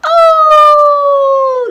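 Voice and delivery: high, loud wolf howl; drawn-out high howl, sliding slightly down. Shown as a demonstration. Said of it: "how the wolf does".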